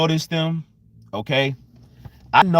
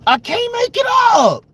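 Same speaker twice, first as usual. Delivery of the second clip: incredulous shout. Real, not acted. Exasperated disbelief, repeated phrase.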